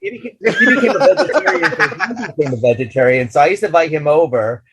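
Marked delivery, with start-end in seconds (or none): 0.0-1.7 higher, laughing as he says it; amused laughing version. Real, not acted. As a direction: giggle through the sentence. Laughing retelling of the fan.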